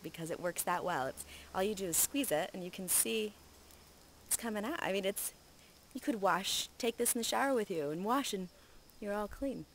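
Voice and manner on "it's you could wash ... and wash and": ordinary talk, nothing marked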